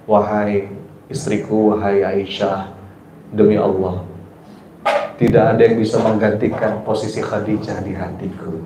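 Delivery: calm, tender husband reassuring his wife; calm gentle reply. Retold or imitated. The Prophet calmly answers jealous Aisyah, tender and reassuring. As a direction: soothe her jealousy gently and lovingly. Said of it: "Terus Rasul menjawab".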